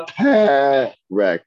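PEREK with two open short eh vowels. Shown as a demonstration. Teacher correcting students on the segol, short eh vowels in PEREK. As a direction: say PEREK slowly as peh-rek, short eh twice. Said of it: "The eh, eh. So go".

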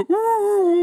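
high squealing ooh; mock gasp of excitement. Friend mocking a buddy's golf-ticket thrill, squealing.

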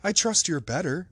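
high-pitched, eager greeting; keen, hopeful well-wishing; cried out. A narrator's reading. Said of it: "he cried".

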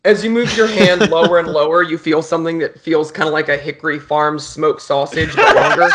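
laughing through the words; can barely finish for laughing. Real, not acted. GM cracks up mid-description.

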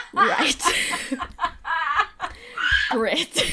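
high, loud laughter round a flat Right and Great; helpless laughter. Real, not acted. After the clerk's endless directions, laughing in exasperation.

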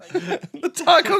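laughing high and slow; giggling repeat. Real, not acted. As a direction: squeal it through a laugh. Laughing echo of taco goggles.

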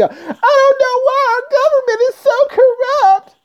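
high squeaky mock voice; mocking whiny voter. Vlogger mocks voters whining about corruption. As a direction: mimic a clueless voter whining.

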